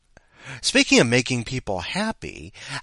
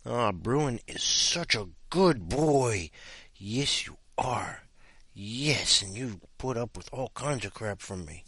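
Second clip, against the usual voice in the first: quiet, tender talk to a pet; 6 dB quieter, slower than usual. Real, not acted. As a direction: coo gently at the dog. Talking softly to dog.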